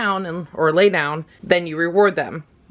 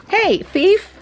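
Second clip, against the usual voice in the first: playful scolding; slower than usual. Real, not acted. Teasing the dog that sneaks a cookie, high mock scold. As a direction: tease the dog for stealing.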